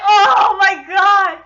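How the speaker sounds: loud, slow, drawn-out amazement; swooning. Retold or imitated. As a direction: stretch it out as you swoon. Retelling own swooning reaction.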